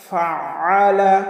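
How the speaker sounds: ফাআলা slow, with the middle আ pressed from the throat; ayn pronounced fully. Shown as a demonstration. Teacher recites it rightly, ফাআলা with throaty ayn. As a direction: chant "fa-'aa-la" for ফাআলা, squeezing the ayn. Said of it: "বলবো না ফালা".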